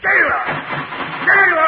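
loud, high calling out; shouting. Acted. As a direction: yell for the jailer down the hall. Prisoner calling out for guard.

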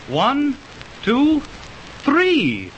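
excited stargazer, voice pitched high; excited counting. Acted. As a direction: count them out with rising glee. Counting shooting stars excitedly.

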